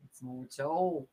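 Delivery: a light Australian vowel on tall, drawn out slowly; Australian tall, long and open; Australian accent. Shown as a demonstration. Host demos the Australian vowel for chat, lightly Australian. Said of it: "the Australian is tall".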